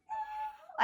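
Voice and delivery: thin, very high mock squeal. Friend retells seeing a bat in the room, mock squeal.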